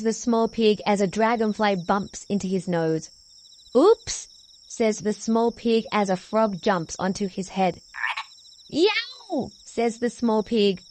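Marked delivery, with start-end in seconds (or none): 3.7-4.3 high, loud little yelp; storybook pig caught by surprise; startled oops. A narrator's reading. Pig startled by jumping frog. 8.7-9.5 very high-pitched yowl; storybook pig's cry of pain; pained yelp. A narrator's reading. Pig bitten on tail by turtle.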